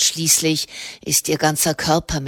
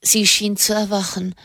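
usual speaking voice

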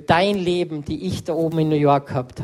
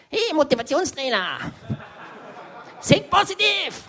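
high, shouted mock pep-talk; jeering motivational shout; friend's pushy cheer; 10 semitones higher than usual. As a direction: yell it like an overeager buddy poking your belly. Friend pokes him, teasing.

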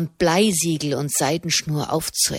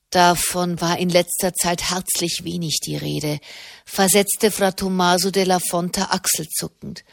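plain reading voice

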